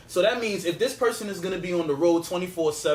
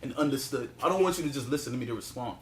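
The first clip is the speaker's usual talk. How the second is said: calmer, lower voice; 6 semitones lower, 5 dB quieter, faster than usual. Real, not acted. Husband calming the argument, speaking lower and softer. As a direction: lower and softer, deliberately calm. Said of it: "I don't, I don't wanna shout".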